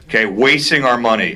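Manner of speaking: ordinary talk, nothing marked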